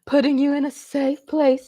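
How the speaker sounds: usual speaking voice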